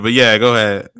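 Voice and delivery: ordinary talk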